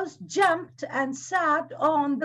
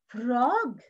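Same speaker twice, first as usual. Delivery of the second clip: ordinary delivery, nothing marked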